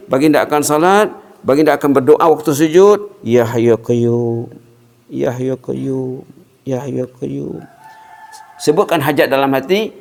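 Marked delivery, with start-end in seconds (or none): 3.2-7.6 drawn-out chanted invocation; slow dhikr. Acted. Preacher chanting the Prophet's prayer, slow and devout. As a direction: stretch each name slowly, devotional.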